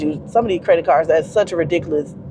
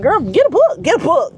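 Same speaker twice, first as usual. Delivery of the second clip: emphatic advice; 9 semitones higher than usual. Retold or imitated. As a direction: push it on her, get a book. I was like.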